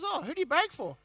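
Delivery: a touch of a pleading newsman; editor. Retold or imitated. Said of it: "He says".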